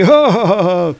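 high, loud stagey "ha ha"; villainous laugh. Acted. Lear laughing over fallen Thor, gloating stage laugh. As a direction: laugh in triumph, hammed-up. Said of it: "And Lear's laughing".

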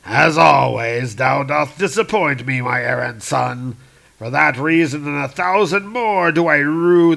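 slow, thundering godly rebuke, loudest on "Go ye both"; a disappointed father banishing his sons; hammed-up wrathful king of the gods; angry Zeus. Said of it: "Zeus now is really pissed off".

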